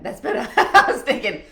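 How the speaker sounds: high, loud laughing speech; amused, breaking into laughs. Real, not acted. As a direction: talk through real laughter. Laughs at a viewer's reply.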